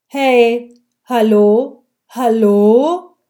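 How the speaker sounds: loud calls, rising at the end; a woman calling to a policeman to get noticed, loud. A narrator's reading. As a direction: call across the street for the officer's attention.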